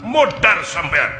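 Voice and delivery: threat. Acted. Acted fight threat.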